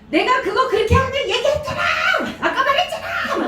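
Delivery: put-on very high-pitched child's yelling; frustrated little girl. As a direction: imitate an exasperated kid scolding family. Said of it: "그럼 걔가 이래요". (답답해하는 어린아이 흉내)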